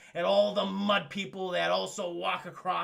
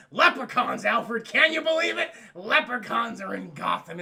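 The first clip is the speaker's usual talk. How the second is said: mock astonishment; 6 dB louder than usual. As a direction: burst out in hammed-up disbelief. Retold Batman ranting to Alfred.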